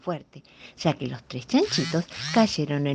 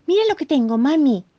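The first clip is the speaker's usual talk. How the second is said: high, louder proud call; eager exclamation; 9 semitones higher, 6 dB louder than usual. Acted. The bunny showing his mother the scarf, eagerly. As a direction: show it off to mum. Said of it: "exclamó desenrollando la bufanda".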